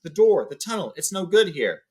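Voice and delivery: gasping warning to the dwarves; urgent gasp. Acted. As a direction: push them to the tunnel in alarm. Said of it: "he gasped".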